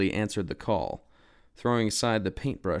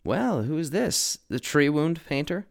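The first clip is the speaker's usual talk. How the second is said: higher, slow mocking question; sarcastic; 7 semitones higher, 3 dB louder than usual. A narrator's reading. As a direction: drag it out with scorn. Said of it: "asked rather sarcastically".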